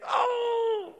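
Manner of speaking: high, drawn-out mock "oh"; shocked reaction. Congregant hearing Jesus's hard sayings, mock shock. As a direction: let it sail up high in exaggerated dismay.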